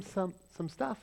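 ordinary talk, nothing marked

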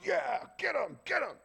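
high, loud mock cheer; gleeful shout from heaven. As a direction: cheer on the wrath like a fan. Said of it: "stand up there and go".

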